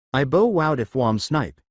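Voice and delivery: slow, r turned to w throughout; lisping disguise voice, slowed down; hunter's lisp. Acted. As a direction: drag it out with a cartoon lisp. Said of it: "why are you speaking with an accent".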